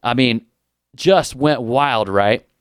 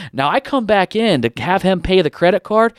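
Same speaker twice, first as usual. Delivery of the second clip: ordinary talk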